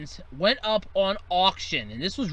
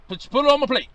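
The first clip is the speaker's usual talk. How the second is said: louder, higher eager call; 5 semitones higher, 5 dB louder, faster than usual. Acted. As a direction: call for food to be served. Calling across a barbecue.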